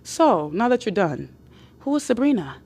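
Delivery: soft, composed return to the question; calm. Acted. Woman waits out his outburst, calm. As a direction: let the silence sit, then ask quietly. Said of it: "it's gonna get silent".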